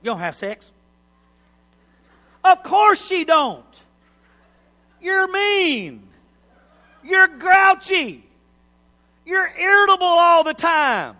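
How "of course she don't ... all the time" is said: loud, high, drawn-out rebuke; comic high-pitched preacher's tirade; mock scolding shout. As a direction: holler the blame at a grouchy husband. Preacher scolds an imagined grouchy husband.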